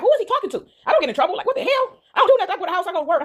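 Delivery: high-pitched, bewildered self-defense; indignant disbelief, squeaking upward; confused protest. Retold or imitated. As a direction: act out baffled innocence, pitch jumping way up. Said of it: "I'm looking so confused".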